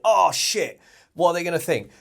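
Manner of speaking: high and loud sudden dismay; the bar just dropped, blurt it out; dismayed curse. Retold or imitated. Athlete misses the lift.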